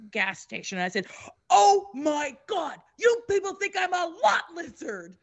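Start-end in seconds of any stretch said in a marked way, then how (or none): 1.5-5.2 high, appalled outcry with drawn-out start; retold outrage, pitched way up; mock screaming. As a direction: exclaim it in scandalised disbelief. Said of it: "I'm just screaming".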